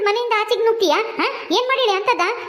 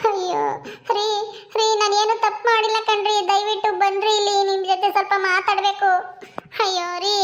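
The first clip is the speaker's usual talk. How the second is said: normal talking voice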